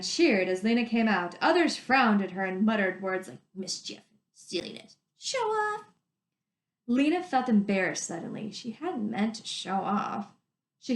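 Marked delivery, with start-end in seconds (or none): 5.2-5.9 high, mocking voice from the crowd; sneering jeer. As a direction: toss the jab at her. Muttering crowd member.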